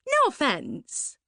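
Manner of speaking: put-on high, breathy-bright posh young woman; a high, gushing party girl; Suzanne's gushing voice. To Laurie at the party, a high gushing voice.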